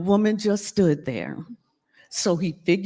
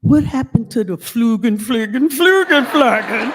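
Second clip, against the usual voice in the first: teasing mock German; 7 dB louder than usual.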